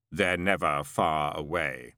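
slow, echoing, otherworldly voice; deep calm of an immortal; voice of Death. A narrator's reading. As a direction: speak as the ancient angel of death, unhurried. Death departs.

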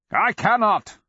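high, loud dragon roar; booming dragon voice. A narrator's reading. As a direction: roar the refusal.

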